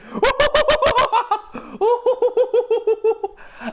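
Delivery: high manic cackle; Joker cackle. Shown as a demonstration. Finishing the Joker impression, high manic cackle. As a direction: let a wild villain laugh rip. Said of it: "decent at Heath Ledger's".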